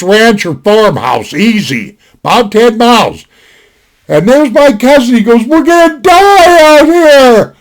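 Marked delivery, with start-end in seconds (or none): 5.5-7.5 slow, high, loud panicked wail; mock panic. Cousin wailing in panic, slow and loud. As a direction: drag it out in a hammed-up howl of doom.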